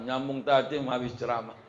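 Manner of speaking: usual speaking voice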